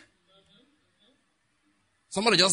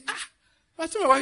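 ordinary talk

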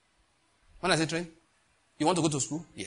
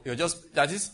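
normal talking voice

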